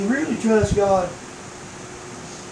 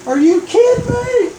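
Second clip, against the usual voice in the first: very high-pitched comic disbelief; incredulous. Acted. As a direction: squeal it like you cannot believe it. Man disbelieves the voice's advice.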